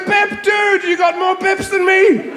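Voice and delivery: high, loud, slow dude voice; hammed-up gamer bro shout. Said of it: "they're going".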